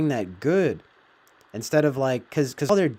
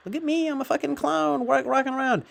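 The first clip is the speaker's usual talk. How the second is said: mocking high squeaky caricature voice; 13 semitones higher than usual. Vlogger mocking TikTok attention seekers, high mocking caricature. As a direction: ham up a high attention-seeking clown.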